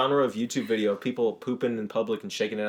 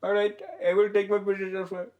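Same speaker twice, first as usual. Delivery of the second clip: put-on Indian accent; 7 semitones higher than usual. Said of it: "So Bigfoot says".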